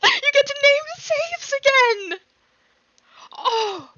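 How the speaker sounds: high, loud squeal of excitement; dramatically excited. Real, not acted. Gamer thrilled saves can be named, excited squeal. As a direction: burst out with real, over-the-top delight. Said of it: "that's a very dramatic reaction".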